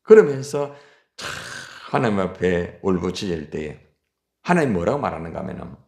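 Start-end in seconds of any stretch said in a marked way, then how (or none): none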